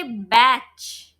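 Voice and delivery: "batch" ending in a "ch" sound; drawn-out "batch" with a ch ending; wrong final sound. Shown as a demonstration. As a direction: show "batch" with ch where hard G belongs. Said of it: "We don't say".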